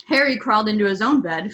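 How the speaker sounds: ordinary delivery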